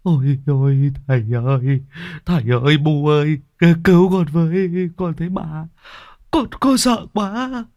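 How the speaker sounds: high, frightened wail to parents; tearful whimpering. A narrator's reading. Đồn clings to his parents after seeing a ghost, tearful wailing. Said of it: "ôm lấy bố mẹ mà mếu máo".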